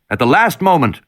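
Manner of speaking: ordinary delivery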